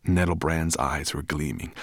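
usual speaking voice

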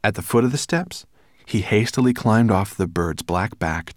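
usual speaking voice

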